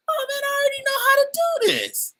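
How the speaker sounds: loud, very high, quick exclamation; retold thrill. Telling of learning the dance, in high, loud excitement. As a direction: reenact bursting out with excited confidence.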